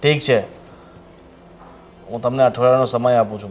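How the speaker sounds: loud, firm warning to a debtor; stern ultimatum. Acted. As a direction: lay down a deadline forcefully. Lender gives debtor a final deadline. (કડક અવાજે મોટેથી ચેતવણી)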